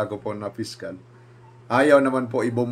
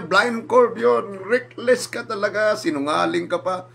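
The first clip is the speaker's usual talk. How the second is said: higher, slow, scolding retold yell; mock shouting; 10 semitones higher, slower than usual. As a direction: imitate the director shouting you down. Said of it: "Sinigaw-sigawan ako nun". (ginagayang pagsigaw ng direktor)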